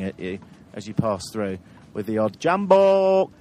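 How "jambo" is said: long drawn-out loud greeting call; high, elongated village greeting shout; mock big scream. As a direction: holler it high and stretch it out. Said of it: "give it a big scream and a wave".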